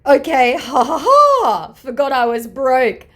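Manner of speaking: high, loud, slow mocking laugh; gloating scammer voice. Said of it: "he's come back with".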